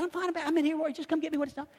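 high, soft and easygoing; soft, high-pitched no-worries tone; breezy reassurance. Retold or imitated. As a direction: wave it off in a light high voice. Reassuring the apologetic employee.

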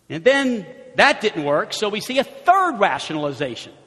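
normal talking voice

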